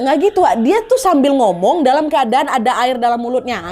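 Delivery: plain reading voice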